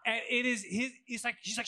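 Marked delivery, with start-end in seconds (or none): none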